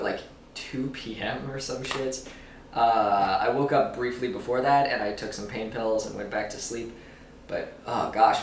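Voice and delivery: ordinary delivery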